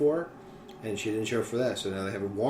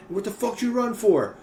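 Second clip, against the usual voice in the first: cop yelling at the runner, higher; a hint of an exasperated cop; angry cop; 9 semitones higher, 4 dB louder than usual. Cop confronting a fleeing driver, mock exasperated. Cop voiced in Cops story.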